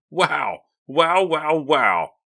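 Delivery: high, loud repeated exclamations; excited cry. A narrator's reading. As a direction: burst out in giddy amazement. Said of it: "Mickey cried".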